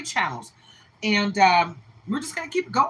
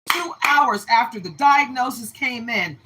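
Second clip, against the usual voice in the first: usual speaking voice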